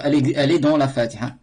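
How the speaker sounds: ordinary talk, nothing marked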